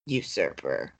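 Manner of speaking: low, soft, ominous accusation; Stephen. Retold or imitated. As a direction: drop deep and quiet for a mock-dramatic verdict. Voices Stephen's final brooding accusation.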